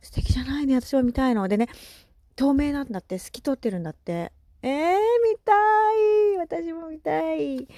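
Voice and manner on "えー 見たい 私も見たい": high, drawn-out eager wail; excited longing. Real, not acted. Eagerly wishing to see fairies.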